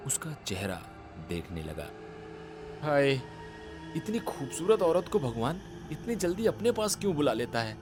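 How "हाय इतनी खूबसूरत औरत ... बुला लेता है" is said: high, quick, theatrical lament; lamenting admirer. A narrator's reading. Umesh pining over a beautiful dead woman, lamenting. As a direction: sigh over her beauty in a much higher put-on voice.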